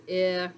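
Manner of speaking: "eh" as an isolated short I vowel; short I sound. Shown as a demonstration. Teacher reviewing short I with second graders, short vowel sound. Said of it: "short I says".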